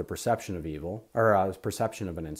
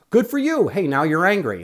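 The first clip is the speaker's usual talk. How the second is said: sarcastic jab; 9 semitones higher, 11 dB louder than usual. Retold or imitated. Sarcastic scolding of angry person.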